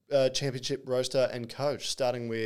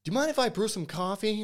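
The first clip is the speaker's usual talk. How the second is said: loosely mimicked Kai accent, the question rising much higher; imitating Kai's accent; 8 semitones higher, 5 dB louder than usual. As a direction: quote Kai in a rough copy of his accent, climbing high at the end. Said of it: "I hope I'm not butchering his accent".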